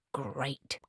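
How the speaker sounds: unimpressed whispered great; dry whisper. Acted. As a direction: toss off great under breath. Tree answers dryly.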